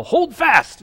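high, loud sailor's call; imitated pirate yell. Imitating a pirate film for the congregation, high mock yell. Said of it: "somebody yell".

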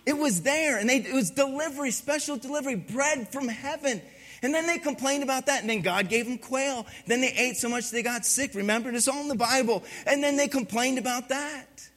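ordinary talk, nothing marked

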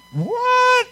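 high, loud disbelieving yelp; incredulous what. Acted. The people react to God's answer, a shocked high what. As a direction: squeak in disbelief.

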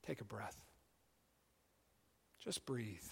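quiet meditative invitation; hushed and prayerful. Acted. Leading a quiet breathing moment.

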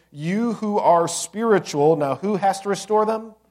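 loud, rising pulpit call to the brothers; preaching at full force. Acted. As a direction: lift the whole room with it.

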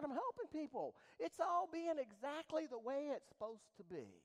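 loud, high preacher's cry; preaching at full force. Acted. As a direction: preach it out to the back pews.

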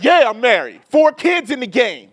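a mock-tough retort to a stranger; talking tough. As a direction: puff up and answer back hard. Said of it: "you gotta talk back tough".

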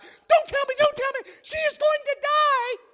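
husband imitating wife panicking at a thriller; wife's mock panic. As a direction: scream at the screen as the wife. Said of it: "and she's like".

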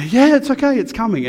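sing-song reassurance to a pushy customer; mock reassuring. Reassuring the impatient lady.

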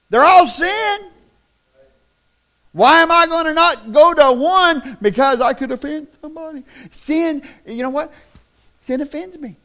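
loud, high, driving sermon voice; fiery pulpit delivery; full-force preaching. Acted. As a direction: preach it hard to the congregation.

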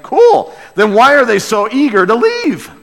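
high, bright-eyed fake "cool" then a loaded question; mock cheerful sarcasm.

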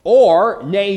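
ordinary talk

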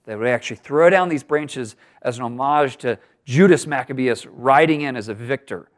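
ordinary talk

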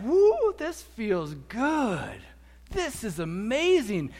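high, loud woo, then slow savoring; whoop, then stretch out the delight; acted elation. Believer feeling the Holy Spirit in first steps of faith, hammed-up elation.

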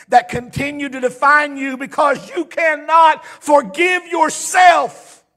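swelling pulpit delivery; slow down and press each word; impassioned preaching. Real, not acted. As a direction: build to a loud, drawn-out finish. Preaches at full force.

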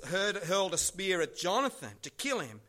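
normal talking voice

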